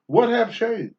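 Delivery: usual speaking voice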